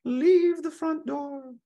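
very high, slow, eerie little voice; a small ghostly voice inside his head; tiny inner voice. Acted. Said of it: "little voice in the back of his head".

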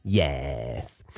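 low, soft drawn-out yes; lightly put-on comic voice, dropped low; silly character voice. Silly voice aside in intro.

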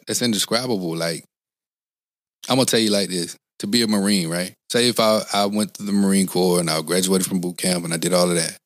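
normal talking voice

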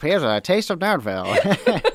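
barker shout with a rising tune; loud carnival barker. Pretend. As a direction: hawk it to a passing crowd. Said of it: "Step right up".

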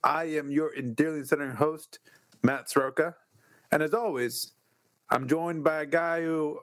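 high-pitched ringmaster announcer. Acted. Podcast intro announcement.

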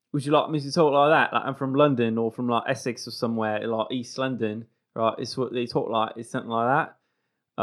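East London accent demo; casual London English accent. Shown as a demonstration. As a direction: talk like an Essex or East London local. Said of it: "give us a little bit of your, um, English accent".

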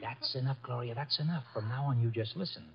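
put-on hushed command to a frightened girl; firm hush. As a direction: shut her up quietly but firmly. Archie hushes Gloria.

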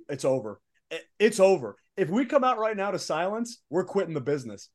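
acting out a rising inner panic; retold panic. Wrestler relives dread of silence at his entrance, retold panic.

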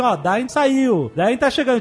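slow, drawn-out announcing; slow raven message. Retold or imitated. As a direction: spell it out slowly like a messenger. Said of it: "os corvos vêm e falam assim". (mensagem lenta de corvo)

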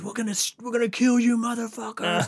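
high-pitched retold threat shouted into the woods; mock threatening yell. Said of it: "they're yelling to the woods".

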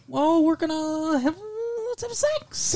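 high breathy attempt at sexy; high sexy voice imitation. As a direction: squeak it up high like a nervous seduction. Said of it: "that voice that goes up like that".

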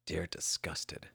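whispered reading of the salutation; hushed reply. Acted. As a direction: breathe it out slowly like a secret letter.

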